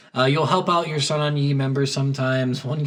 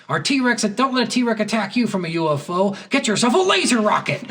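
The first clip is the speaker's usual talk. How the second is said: higher, pumped-up ad pitch; mock commercial announcer; 7 semitones higher than usual. Comedian's fake Super Bowl ad, hammed-up announcer voice. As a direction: sell the laser rocket like a hammy TV spot. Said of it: "and he's like".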